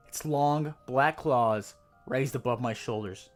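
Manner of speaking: ordinary delivery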